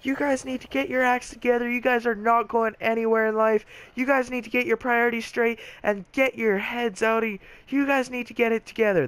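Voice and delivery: high-pitched, exasperated mock tirade; imitating ranting teacher. Teacher at front of room berating students, mock ranting. Teacher lectures whole class in anger.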